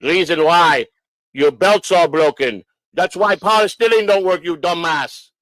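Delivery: put-on emphatic Polish-accented English. Inspector scolding the owner over broken belts, Polish accent. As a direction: imitate a Polish mechanic laying out the fault. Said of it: "he sounded Polish to me".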